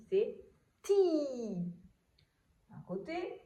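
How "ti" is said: "ti" with a long held i; crisp T sliding into a stretched "ti"; syllable demonstration. Shown as a demonstration. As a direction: sound out "ti" for a beginning reader. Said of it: "nous allons dire".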